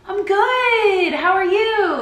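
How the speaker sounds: high, loud imitation of a polite greeting; mock-friendly reply. Woman answers a stranger she may not know, over-friendly.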